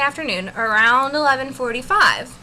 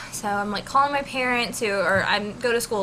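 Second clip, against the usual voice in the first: ordinary delivery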